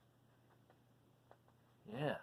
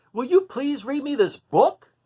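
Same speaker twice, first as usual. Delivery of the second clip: eager loud plea; 7 semitones higher, 10 dB louder than usual. Acted. Duncan asks the bull.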